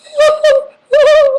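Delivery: loud, very high drawn-out "whoo"; high strained whoop. Retold or imitated. Clenching the hidden drugs while cops search, high strained whoops. Shows holding herself together, like this.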